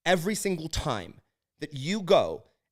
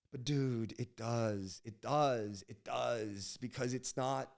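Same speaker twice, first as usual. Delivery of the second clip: sighed inner voice; 3 semitones lower, 10 dB quieter, much slower than usual. Retold or imitated. Inner voice, biting his tongue.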